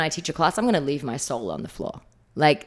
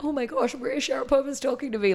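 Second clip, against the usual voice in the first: starstruck fans; 10 semitones higher than usual. Retold or imitated. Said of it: "like, not knowing what to do".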